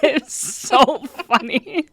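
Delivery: slow, high words through laughter; laughing. Real, not acted. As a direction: giggle it out. Laughing fit.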